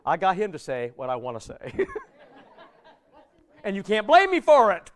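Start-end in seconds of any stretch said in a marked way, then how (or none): 1.8-3.6 high laugh. Real, not acted. Laughs at own trick.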